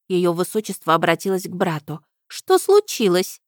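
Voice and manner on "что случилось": high, loud demanding princess; indignant princess. A narrator's reading. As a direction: demand answers like a spoiled princess. Princess asks.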